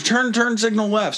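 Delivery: high-pitched retold order; mock commanding. Worker directing a driver's turn signal, mock commanding. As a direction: mimic a bossy instruction. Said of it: "she starts commanding me".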